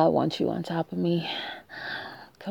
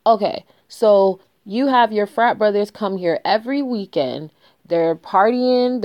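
ordinary delivery

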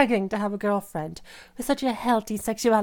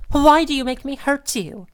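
higher, louder accusing cry; angry outburst; 5 semitones higher, 6 dB louder than usual. Acted. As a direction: blame them with a raised voice. Abuser blaming partner.

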